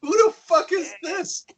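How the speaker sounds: startled. Retold or imitated. Startled by knock while tripping.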